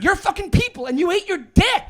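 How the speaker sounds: high, forceful dressing-down; heated berating. Real, not acted. Comic berating the absent friend over bombing, heated rant. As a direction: rub the failure in, worked up.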